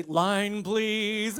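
loud, playful family catchphrase boom; mock booming voice. Relative teasing at Christmas dinner, mock booming voice. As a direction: imitate a booming stage Noah. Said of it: "somebody will say".

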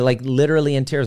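usual speaking voice

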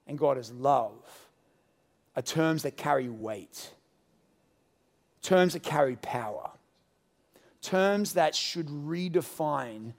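low, slow, weighty sermon voice; solemn preaching. Real, not acted. Preacher pressing the congregation on God's light and love, low and slow. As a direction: let each phrase land heavy and slow.

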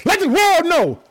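high, loud voice of an excited God; pumped up. Acted. God setting the prisoners loose, pumped up. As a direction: shout it high, bursting to act. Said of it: "He got so pumped up".

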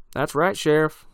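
loud, high affirmation; a farmer loudly backing the townie; emphatic agreement. A narrator's reading. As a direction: shout your agreement.

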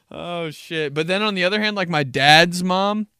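ordinary talk, nothing marked

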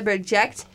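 stretched, stage-taught PROJECT; over-enunciated PROJECT. Shown as a demonstration. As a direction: say PROJECT like a drilled theater kid. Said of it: "I've been taught to project".

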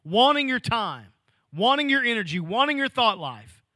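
rising and falling preacher's cadence; preaching. Acted. Pastor preaching to his congregation, impassioned cadence. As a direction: drive the point home to the church.

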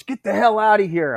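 imitating a sergeant ordering someone out; retold drill-instructor yell.

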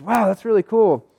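high, loud imitated enthusiasm; impressed. Friend hearing about the New Orleans trip, impressed. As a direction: play up being impressed by a pal's news.

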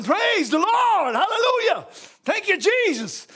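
high, loud Hallelujah shout; joyful shouting. Retold or imitated. Farmer Brown shouting praise in church, loud joy. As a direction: shout praise like a man overcome. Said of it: "he was shouting".

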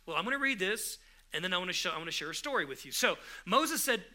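usual speaking voice

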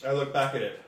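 ordinary talk

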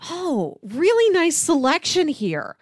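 usual speaking voice

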